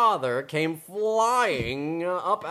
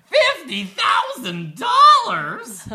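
high, loud, slow amazed cry; father stunned by the money; astonished shout; 8 dB louder, slower than usual. Acted. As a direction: gasp it out in disbelief. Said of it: "Her father said".

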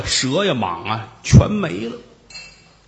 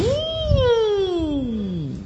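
high drawn-out howl of wind; 3 dB quieter than usual. Retold or imitated. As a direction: moan it long like an eerie wind. 阴风阵阵 wind howling. (模仿阴风呜呜声)

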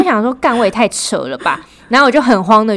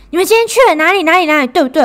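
imitating a demanding mom, higher-pitched; mom interrogating; 9 semitones higher, 4 dB louder, faster than usual. Mom calls to grill her kid about the day, in a demanding voice. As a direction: grill your kid over the phone.

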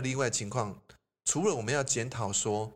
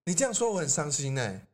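higher, wounded complaint; hurt pretend; 6 semitones higher than usual. Teacher plays the offended friend, mock hurt. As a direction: act hurt and let it show. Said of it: "那你骂我".

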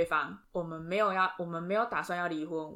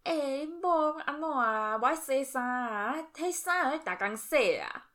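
put-on higher, slow, old Taiwanese mother's complaint; complaining mother-in-law; 7 semitones higher, slower than usual. Mother-in-law complaining to her son about his wife, slow and high. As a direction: drag it out like a fussy mother-in-law grumbling in Taiwanese. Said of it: "就会去跟她儿子说嘛".

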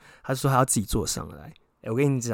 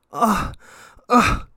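very high loud mock cry; hammed-up high moan; imitated moan; 10 semitones higher, 9 dB louder than usual. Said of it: "一进去".

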